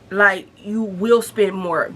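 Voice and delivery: ordinary delivery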